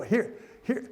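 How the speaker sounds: chuckling through the words, pitch lifted; laughing. Real, not acted. Pastor chuckling about his wife's romantic side, laughing. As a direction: laugh as you stumble over it.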